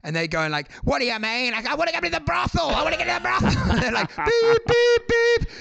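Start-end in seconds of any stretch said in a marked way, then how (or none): 0.8-3.6 put-on high pleading drunk voice; whiny drunk customer. Drunk customer begging the ATM, high whiny protest. 4.1-5.5 high, loud machine beeping; imitated alarm beep. ATM blocking a drunk customer, high loud beeps.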